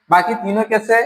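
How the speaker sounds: ordinary talk, nothing marked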